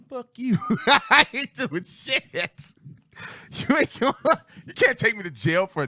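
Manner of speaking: high, loud mock comeback; laughing defiance. Grown-up self talking back to the manager, laughing defiance. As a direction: defy him while cracking up.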